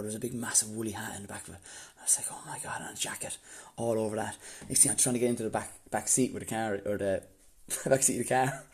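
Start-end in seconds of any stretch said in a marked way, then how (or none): 0.0-4.4 hushed whisper. Retold or imitated. Sneaking into his brother's car, whispering. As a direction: whisper it, quick and secret.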